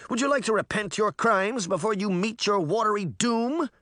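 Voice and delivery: high, grandly menacing question; mock-solemn threat, pitched high; theatrical boy villain. A narrator's reading. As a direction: pronounce doom like a child playing villain. Boy captor mockingly sentences prisoner.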